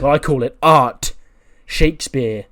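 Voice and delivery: loud acted declaration; emphatic proclamation. [name] correcting Sensei about anime, loud emphatic proclamation.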